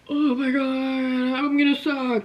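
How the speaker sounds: high, slow whiny mock panic; mock panicked self. Singer mocking her nervous self to viewers, whiny high panic. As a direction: ham up the nervous wreck voice. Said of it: "I am not going into this like".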